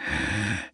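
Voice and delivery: a short disbelieving laugh, breathy and soft; brief mocking laugh of disbelief; incredulous laugh. A narrator's reading. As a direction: scoff out a laugh you can't believe. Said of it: "scoppiò in una risata incredula".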